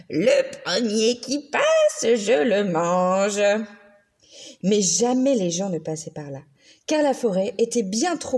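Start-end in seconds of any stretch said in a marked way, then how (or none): 0.1-3.4 slow, sneering monster voice; sinister monster. A narrator's reading. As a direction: menace it slowly like a hungry beast. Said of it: "disait avec des ricanements sinistres".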